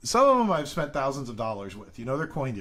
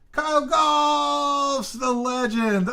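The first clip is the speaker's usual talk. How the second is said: excited drawn-out shout; 13 semitones higher, 7 dB louder, much slower than usual. Real, not acted. Hails a famous viewer by name.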